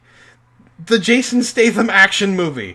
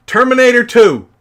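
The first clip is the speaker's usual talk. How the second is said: much louder and higher, proudly announcing the title; loud reveal; 6 semitones higher, 10 dB louder than usual. Real, not acted. Telling viewers his second tear-jerker, loud reveal. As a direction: call out the title like a punchline.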